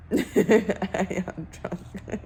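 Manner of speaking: very slow, laughing through the words. Real, not acted. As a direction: giggle out the confession, drawn out. Repeats it, laughing.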